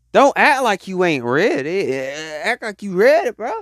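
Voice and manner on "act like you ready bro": usual speaking voice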